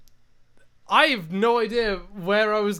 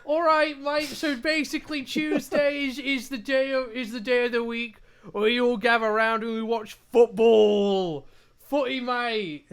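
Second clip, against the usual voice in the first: higher, louder hammed-up Cockney; mock Cockney accent; 4 semitones higher, 4 dB louder than usual. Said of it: "I gotta do a Cockney accent".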